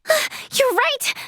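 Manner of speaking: gasp, then high excited confirmation; amazed recognition. Acted. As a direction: exclaim at a thrilling sighting. Excited recognition of horn and skin.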